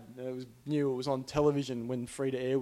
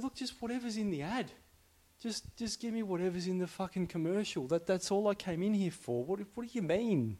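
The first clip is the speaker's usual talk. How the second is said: exasperated; 5 semitones higher, 3 dB quieter than usual. Retold or imitated. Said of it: "I got quite exasperated and upset".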